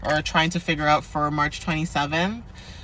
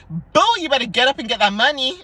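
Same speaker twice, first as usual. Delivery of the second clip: higher, louder bossy alarm-clock hype; playful command to get out of bed; sassy wake-up call; 7 semitones higher, 5 dB louder than usual. Pretend. Her own alarm clock rousing the viewer, sassy hype. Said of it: "an alarm clock with my voice on it".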